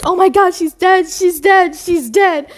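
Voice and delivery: high, loud mock panic; Mary freaking out. Over her fallen sister-in-law, hammed-up shrieky panic. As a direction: wail it in hammed-up hysteria. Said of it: "Everybody is freaking out".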